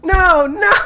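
high, loud wail of defeat; real cry of disbelief and frustration; anguished shout. Real, not acted. Gamer killed just before his ambush, anguished shout. Killed while stalking an enemy.

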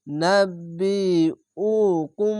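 drawn-out chanted নাব্বিউকুম with a long held final vowel; slow recitation. Shown as a demonstration. Teacher demonstrating Quran reading to students, slow recitation. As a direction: recite নাব্বিউকুম slowly and melodically. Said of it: "teacher demonstrates Quran recitation".

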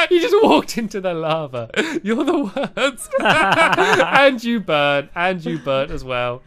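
laughing through the words. Real, not acted. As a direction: giggle while teasing. Laughing at partner in lava.

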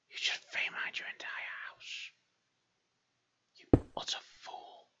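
voiceless, aghast whisper; whispered self-scolding. Acted. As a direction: whisper the reproach to yourself. Scolding self over the damage.

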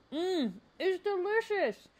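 slow, talking through food; mouth full. A narrator's reading. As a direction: say it around a mouthful. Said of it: "mouth half filled with food".

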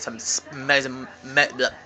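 tongue-tied. Real, not acted. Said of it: "I can't say nothing tonight".

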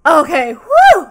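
loud, slow shout of relief; relieved shout. Real, not acted. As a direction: blow out a big loud whew. Relieved after near death in game.

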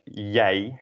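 "jij" with a Y-like J; Dutch word jij. Shown as a demonstration. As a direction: say jij in Dutch, starting with a "y" glide. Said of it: "in Dutch".

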